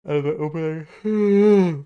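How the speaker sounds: words stretched through a yawn. Real, not acted. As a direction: talk mid-yawn, slow and drawn out. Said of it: "Why do I keep yawning?".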